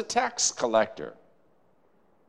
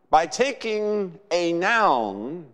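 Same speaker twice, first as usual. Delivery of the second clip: ordinary delivery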